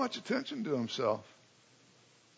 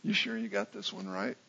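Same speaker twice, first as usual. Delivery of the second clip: softer, lower head-shaking doubt; doubtful John; 4 semitones lower, 11 dB quieter than usual. Retold or imitated. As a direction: mutter it as a sceptical cousin. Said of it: "shaking his head and saying".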